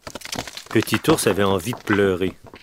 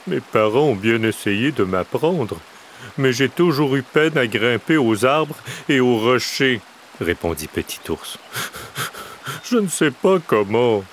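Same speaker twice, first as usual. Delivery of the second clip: a touch louder, lightly voiced bear; sheepish little bear; 4 semitones higher, 4 dB louder than usual. A narrator's reading. Little bear admitting to the crow he cannot climb, sheepish. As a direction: confess it like a small bear.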